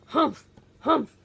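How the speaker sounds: grouchy hammed-up humph; grumpy humph. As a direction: huff it like a sulking pirate. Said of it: "He grumbles and he grouches".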